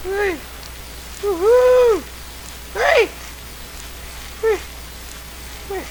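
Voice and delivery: very high yelps and whoops; high excited squeals. Real, not acted. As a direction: squeal with delight and pain. Ragdoll flung around in game.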